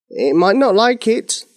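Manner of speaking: high-pitched, worried wizard. A narrator's reading. Wizard warns the King against the spell, worried. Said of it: "looking worried".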